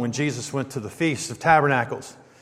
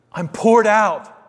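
"poured" lifted high and loud, slow; stress on poured; 6 semitones higher, 4 dB louder, slower than usual. Shown as a demonstration. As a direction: lean hard on "poured", drawn out. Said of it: "Hear that?".

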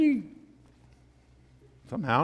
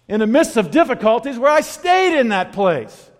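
raised, high preaching voice with emphatic peaks; 9 semitones higher, 9 dB louder than usual. Acted. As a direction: preach it with full force to the congregation. Preacher building to full force.

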